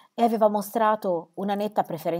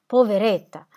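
louder, breaking cry of pity; weeping pity; 3 semitones higher, 7 dB louder than usual. A narrator's reading. Squire weeping over the widow, louder tearful pity. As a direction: let the tears rise. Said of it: "piangendo per la sua sofferenza".

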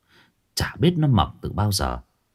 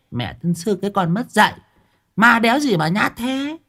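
put-on defiant old woman; 9 semitones higher than usual. Old woman scoffing at the ghost to Uncle Hà, defiant. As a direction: start offhand, build to high-pitched bragging.

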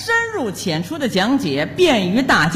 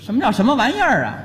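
ordinary delivery

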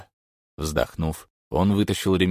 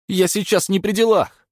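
higher, louder rasping protest; hoarse plea; 14 semitones higher, 6 dB louder than usual. A narrator's reading. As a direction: wheeze out a desperate denial. Pinned thug pleading.

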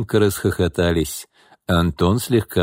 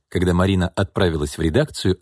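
plain reading voice

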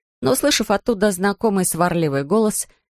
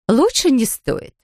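alarmed; 7 semitones higher, slower than usual. A narrator's reading. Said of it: "встревожилась миссис Браун".

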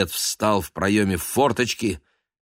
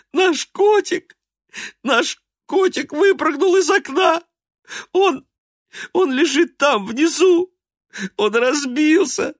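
much higher, louder wailing cry; sobbing child; 7 dB louder than usual. A narrator's reading. Polya running in crying for her mother. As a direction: wail for mother. Girl wails the news.

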